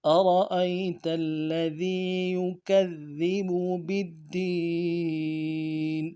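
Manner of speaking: slow melodic tajweed chant; Quran recitation. Shown as a demonstration. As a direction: recite the verse with care.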